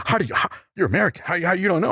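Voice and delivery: high, fast mock disbelief; rapid, incredulous impression of a European; imitating a European fan. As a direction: pitch the European's shocked question way up. European fans question the American.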